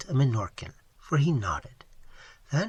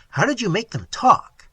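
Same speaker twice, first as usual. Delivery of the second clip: louder, higher incredulous questions; a skeptic doubting every word; 5 semitones higher, 6 dB louder than usual. A narrator's reading. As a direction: challenge her story, pressing hard.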